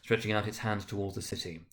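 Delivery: usual speaking voice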